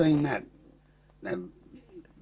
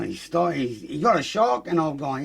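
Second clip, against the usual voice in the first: usual speaking voice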